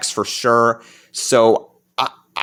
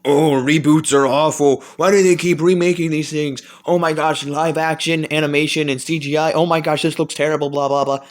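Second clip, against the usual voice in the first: sneering mock-whiny critic voice, slightly higher; mocking complainers; 3 semitones higher than usual. Mocking fans who hate reboots, mock-whiny sneer. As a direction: lightly parody the cynical fans. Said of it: "for everybody who thinks".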